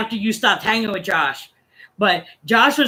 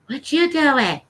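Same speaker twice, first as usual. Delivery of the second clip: higher mimicked child voice; little kid; 4 semitones higher, slower than usual. Little kid questioning a stranger online, mimicked child voice. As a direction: ask it like a curious kid. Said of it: "I can hear this little kid".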